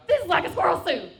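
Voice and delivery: high, loud mock-cute announcement; squirrel suit quip. To the man she's flirting with, a loud high mock-cute line. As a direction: pop it out high like a proud reveal. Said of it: "I went".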